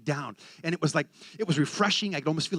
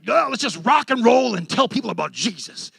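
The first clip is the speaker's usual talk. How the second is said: loud, higher, fired-up call; rallying cry; 5 semitones higher, 6 dB louder than usual. Retold or imitated. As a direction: belt it like a rock frontman rallying. Acts his eager band battle cry.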